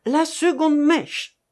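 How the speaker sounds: high, loud, drawn-out command; relentless order. A narrator's reading. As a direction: repeat the order slowly and forcefully. Torturer repeats his order over pleas. (ordre implacable, fort et étiré)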